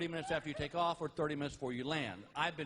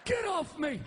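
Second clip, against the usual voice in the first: angry yell; 8 semitones higher than usual. Retold or imitated. Partner crushed after a fall off the bed, yelling high. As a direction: yell at him for landing on you. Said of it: "what do you hear?".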